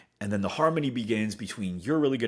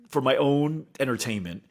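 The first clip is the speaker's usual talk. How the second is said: ordinary talk, nothing marked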